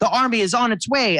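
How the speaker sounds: kobold voice pitched well up, fast and urgent; a small kobold knight rushing in with news; eager little kobold. Acted. Kobold soldier reporting to his goddess, high and hurried. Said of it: "and goes".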